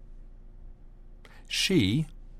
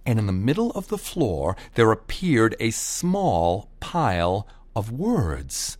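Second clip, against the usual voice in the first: usual speaking voice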